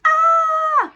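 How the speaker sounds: sudden high sharp shriek, imitating her; imitated scream. Retelling her scream over a toe hit by a seat. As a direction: yelp like a stubbed toe. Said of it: "she, like, screamed".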